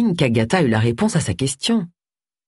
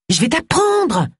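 eager, higher-pitched reply; enthusiastic; 10 semitones higher, slower than usual. A narrator's reading. Roy offers to teach Agatha fishing, enthusiastic. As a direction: answer with keen, lifted enthusiasm. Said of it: "répliqua Roy avec enthousiasme".

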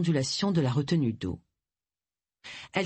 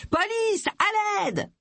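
screaming for help; much slower than usual. A narrator's reading. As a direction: shriek it across the market square. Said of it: "se mit à hurler".

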